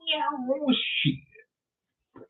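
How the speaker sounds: high gasp then whispered shit; scared gasp. Retold or imitated. Hearing the basement voice, a high startled gasp. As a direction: gasp and hiss it in fright.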